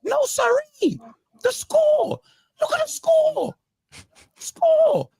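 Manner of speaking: high, thin, breaking squeak with the voice almost gone; strained squeaky voice. Real, not acted. Mates laughing as his voice gives out, strained squeak. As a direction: push the words out through a voice that has cracked up. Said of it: "They've taken his voice".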